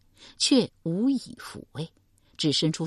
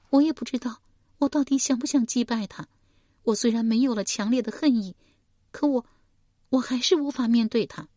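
put-on higher, grieving young woman's voice; young woman recounting her mother's murder, higher voice; young woman Shi Pei; 7 semitones higher than usual. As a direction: tell it as a wounded daughter.

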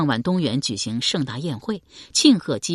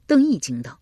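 plain reading voice

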